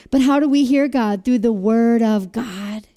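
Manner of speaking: ordinary talk, nothing marked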